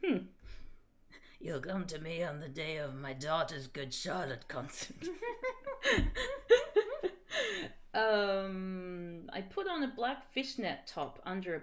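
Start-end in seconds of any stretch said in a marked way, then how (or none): 1.4-5.0 low, put-on mob boss voice; mock-solemn crime boss murmur; Godfather. Parodies the Godfather line. 5.0-7.9 long, high helpless giggle; real drawn-out laughter; laughing fit. Real, not acted. Laughs after own impression.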